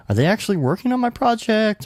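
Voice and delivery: high-pitched imitation of a wondering client; imitating doubtful client. Voices worried clients wondering about progress.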